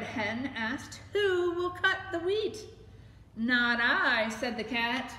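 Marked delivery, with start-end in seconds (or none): 1.1-2.7 hen's voice. A narrator's reading. Hen asks in story. 3.4-4.3 cat's voice. A narrator's reading. Cat refuses in story.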